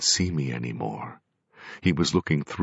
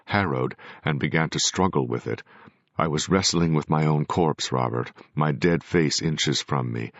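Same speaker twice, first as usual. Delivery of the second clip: ordinary delivery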